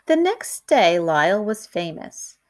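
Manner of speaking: plain reading voice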